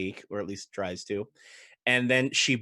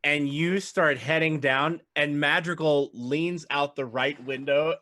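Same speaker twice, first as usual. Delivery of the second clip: normal talking voice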